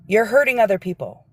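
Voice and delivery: ordinary delivery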